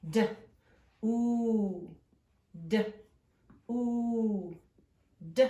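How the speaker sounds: "D, oo" said as separate sounds; letter sounds. Shown as a demonstration. Teacher makes the sounds of the letters in do. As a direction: isolate each sound, d then a long oo. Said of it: "It makes the oo sound".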